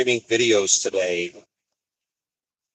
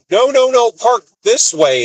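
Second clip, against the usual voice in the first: higher, louder, slowing down on park this way; hammed-up fussy worker correcting the driver; imitating the worker's higher, louder no no no; mock fussy yard worker; 12 semitones higher, 4 dB louder than usual. Yard worker correcting his parking.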